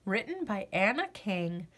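usual speaking voice

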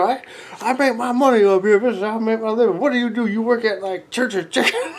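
musician belittling a heckler, high mocking rant. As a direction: talk down to them, half laughing. Performer mocks heckler's job.

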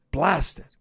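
sharp high, loud outburst; frustrated curse. A narrator's reading. Inspector forced to accept the fox's deal, cursing. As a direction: curse out loud at being cornered.

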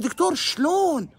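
سؤال مستغرب بطبقة عالية جدًا وممطوطة; اسأل الدكتور مستغربًا وارفع صوتك عاليًا; استغراب. Retold or imitated. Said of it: "استغرب، قلت له". (باستغراب، شلون يعني)

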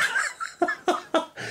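high, airy giggling fit; real delighted laughter. Real, not acted. Reacting to the teddy named Stephen Willis, high breathless laughter. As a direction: laugh softly and helplessly, pitched high.

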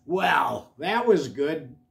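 plain reading voice

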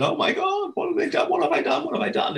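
high squeaky hammed-up panic; imitating his own frantic falsetto dread; mock panic. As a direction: squeal it like the world is ending. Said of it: "full panic mode".

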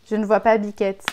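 usual speaking voice

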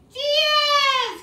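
high, loud, drawn-out final number; triumphant count finish. Real, not acted. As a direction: cheer the last number of the count. Triumphant end of count to ten.

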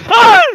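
teriak kaget yang tinggi dan keras; jeritan kaget. Real, not acted. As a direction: menjerit spontan karena terkejut. Kaget lihat sosok. (jeritan kaget sungguhan)

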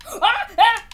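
high loud startled yelps; high yelps as the ship throws him. Real, not acted. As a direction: yelp in sudden alarm. Sudden fall in game.

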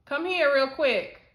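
loud call upstairs. Real, not acted. Calling daughter from upstairs.

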